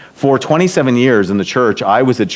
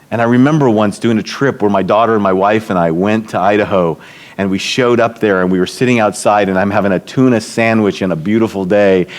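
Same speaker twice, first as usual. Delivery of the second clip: ordinary talk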